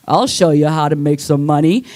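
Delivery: low, suggestive leading-man voice; sleazy porn guy. Retold or imitated. Comic voicing the sleazy porn actor, low and suggestive.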